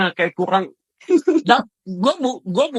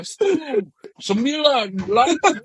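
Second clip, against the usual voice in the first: higher-pitched countdown, calling out each number; mock hype countdown; 6 semitones higher than usual. Mimicking the service countdown before the lights go out, with mock hype. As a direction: count down like a hyped-up stage host.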